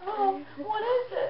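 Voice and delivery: crying. Real, not acted. Opening gift, tearful mum.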